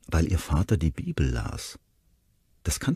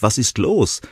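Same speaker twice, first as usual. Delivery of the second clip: called out higher and louder; 6 semitones higher, 4 dB louder than usual. Acted. Dad calling after Ronnie, a raised call. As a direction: call across the house. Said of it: "wie ihr Vater rief".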